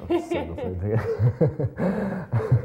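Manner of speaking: slow, laughing repeat of the line. Real, not acted. Actor laughing as he repeats his joke. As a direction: chuckle through it. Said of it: "repeats line, laughing".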